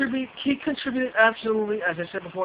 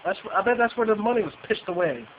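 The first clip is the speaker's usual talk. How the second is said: usual speaking voice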